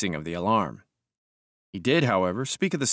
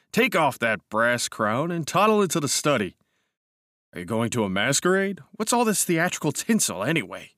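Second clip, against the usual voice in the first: teasing friend voice; 5 semitones higher than usual. Acted. Lewis teasing his cousin.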